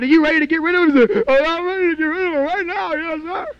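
high, loud, eager "ready to get rid of him"; excited old man ready to quit; eager reply. Retold or imitated. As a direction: jump at the chance. Preacher and Frank, animated retelling.

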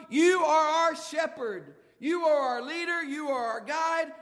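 loud, ringing preacher's cry of praise; preaching at full force. Acted. Preacher lifting the congregation with a shouted psalm. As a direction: proclaim it to the rafters like a revival preacher.

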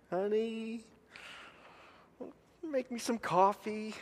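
a hint of coaxing wife asking a favor; wife's sweet request. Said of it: "Tracy's voice".

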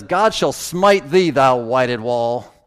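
loud, drawn-out angry retort; lost temper. Retold or imitated. Preacher retells Paul's reply to a slap, angry, loud. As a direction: thunder it slowly, acting out lost temper. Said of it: "He lost his temper".

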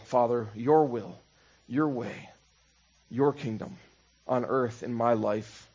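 preacher closes by praying softly to the Father; quiet prayer. Acted. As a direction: let it fade into humble surrender. Closing surrender prayer.

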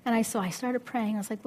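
ordinary talk

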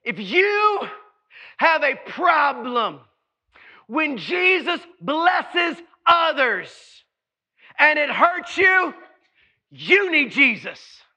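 slow, deliberate, each phrase weighed; careful slow emphasis. Real, not acted. Preacher warning the church, slow pointed emphasis. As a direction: lay it down slowly and pointedly. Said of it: "I have to be really careful how I say".

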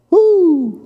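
high joyful woo; a preacher's happy whoop; excited whoop. Real, not acted. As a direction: let out a shout of joy. Preacher exults after verse.

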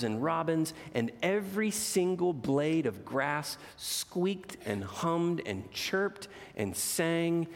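put-on expressive read-aloud to children; gentle storyteller voice for kids; storybook reading. A narrator's reading. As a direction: read it like a bedtime picture book. Reads picture book aloud.

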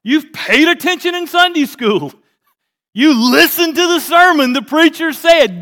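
loud, high, beaming coach's praise; hearty praise. Retold or imitated. Jesus praising the lawyer like a proud coach, loud and high. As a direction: cheer him on like a proud coach. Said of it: "Jesus is like".

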